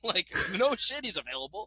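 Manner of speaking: laughing incredulous. Real, not acted. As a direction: laugh it out in disbelief. Laughing at Bucks offer.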